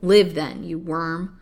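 loud first word, thrown down scornfully; contemptuous curse. Acted. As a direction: spit it at your victim. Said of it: "cried the old witch, throwing life at her poor victim with a curse".

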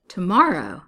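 loud, falling exclamation of dismay; shocked cry. A narrator's reading. Bride-to-be hears the date, shocked cry. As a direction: cry out in disbelief. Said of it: "she cried".

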